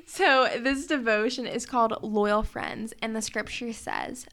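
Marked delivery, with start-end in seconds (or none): none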